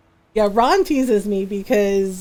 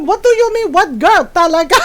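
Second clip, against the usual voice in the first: outraged mom; 6 semitones higher, 6 dB louder than usual. Retold or imitated. Mom's outraged disbelief.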